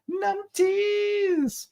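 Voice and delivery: high falsetto squeal, drawn out; silly word-play. Pretend. Playing with the funny word.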